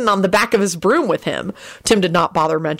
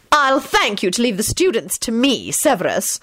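raised-pitch, strident rebuke; 5 semitones higher than usual. A narrator's reading. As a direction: put the meddler in his place. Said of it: "McGonagall said stridently".

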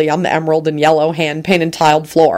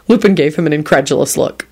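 usual speaking voice

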